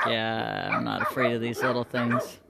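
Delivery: low, soft, puzzled alpaca voice; alpaca voiced. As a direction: lightly voice the confused alpaca. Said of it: "He's like".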